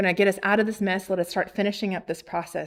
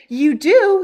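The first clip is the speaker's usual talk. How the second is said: high, loud, incredulous question; astonished delight; 8 semitones higher, 9 dB louder than usual. Retold or imitated. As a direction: stop dead and exclaim in happy surprise. Acts seller's delighted astonishment.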